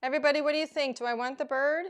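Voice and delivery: ordinary talk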